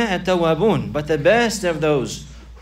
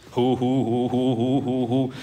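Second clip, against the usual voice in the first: breathy rhythmic hoo chant, lower; mock dance chant; 4 semitones lower than usual. Preacher mocking ecstatic dancers' chants, rhythmic hoo calls. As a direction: imitate a swaying dervish's hoo cries.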